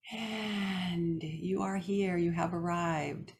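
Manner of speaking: soft, slowly drawn-out arrival; soothing welcome. Real, not acted. Host settles listeners before guided meditation, soothing and soft. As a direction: ease them in gently, stretching the opening words.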